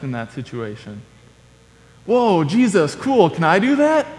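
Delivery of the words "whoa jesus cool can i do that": high, impressed, rising into a question; eager excitement. Acted. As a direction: play an awed disciple itching to try it. Acts out Peter's eager question.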